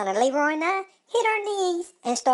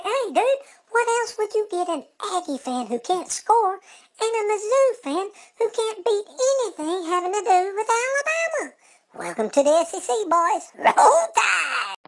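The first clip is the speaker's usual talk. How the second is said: gloating taunt; 7 semitones higher than usual. Retold or imitated. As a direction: rub it in to the newbies. Taunting new conference rivals.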